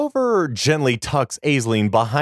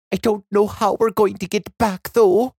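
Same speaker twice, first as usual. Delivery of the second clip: put-on higher, worried girl; young girl voice; 8 semitones higher than usual. A narrator's reading.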